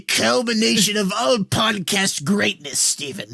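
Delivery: lightly voiced slow villain; hint of a gloating overlord, slowed down; evil overlord. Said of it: "like an evil overlord".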